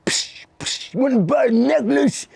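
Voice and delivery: high, hushed hustler hiss into a pitch; pushy street hustler. Retold or imitated. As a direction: mimic the bum pestering at the window. Homeless guy pestering at car window.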